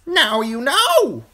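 high, loud eerie crow voice. A narrator's reading. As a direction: caw it out like an uncanny bird. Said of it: "the crow whispered".

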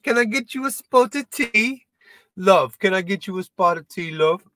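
high, put-on British hostess offering tea; cheerful mock English accent. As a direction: imitate a Brit offering tea. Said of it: "All you Brits bring".

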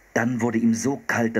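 plain reading voice

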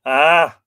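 very loud anguished ah; a cry torn from the chest; terrible cry. Acted. Fionn learns his wife was taken, terrible anguished cry. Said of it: "cried Fionn in a terrible voice".

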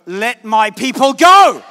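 booming command, slow and loud; Moses shouting. Acted. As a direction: roar it like Moses before Pharaoh.

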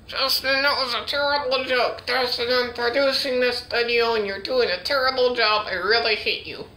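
high-pitched angry puppet; hammed-up high-voiced telling-off; scolding puppet voice. Acted scolding in ventriloquist skit.

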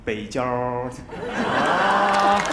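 low, heavy Beijing erhua; Beijing accent. Pretend. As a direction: roll the er like a Beijinger. Mock Beijing erhua, showing off.

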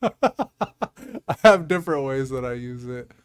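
loud, slow start through a real laugh. Real, not acted. Host explains own Bard use, laughing. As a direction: talk while still laughing.

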